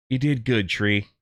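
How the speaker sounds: pat the tree and say goodbye gently, low; tender farewell. Retold or imitated. Elf comforts tree before cutting.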